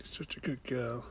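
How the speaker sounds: slow, soft pet praise; quiet slow praise for the cat. Real, not acted. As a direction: murmur it slowly and lovingly. Praising her cat softly.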